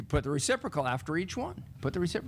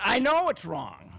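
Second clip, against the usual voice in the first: slow, louder, higher exasperated student; annoyed; 5 semitones higher, 5 dB louder, slower than usual. Retold or imitated. Mary tells the teacher she knows, annoyed. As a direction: drag it out like she's fed up. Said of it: "she was quite annoyed".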